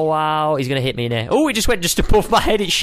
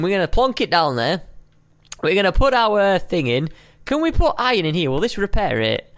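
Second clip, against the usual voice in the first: ordinary talk, nothing marked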